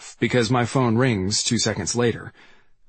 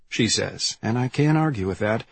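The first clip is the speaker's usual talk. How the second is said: ordinary delivery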